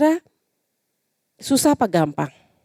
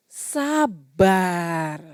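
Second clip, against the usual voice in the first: soft, lower sabar on the out-breath; calm exhale; 3 semitones lower, 4 dB quieter than usual. Shown as a demonstration. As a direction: say it slowly to calm yourself. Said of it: "Tarik nafas lagi". (sabar pelan dan lembut setelah tarik napas)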